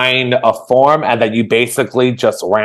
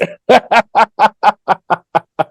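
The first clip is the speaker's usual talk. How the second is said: high laugh; 12 semitones higher, 5 dB louder than usual. Real, not acted. Laughs after tall remark.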